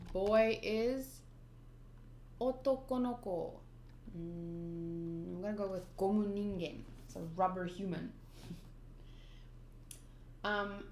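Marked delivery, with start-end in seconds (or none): 2.4-6.8 Otoko no ko and Gomu Ningen in Japanese sounds; Japanese words. Shown as a demonstration. Translating the nickname, trying the Japanese words. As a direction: mumble the Japanese words to yourself. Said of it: "Rubber is Gomu".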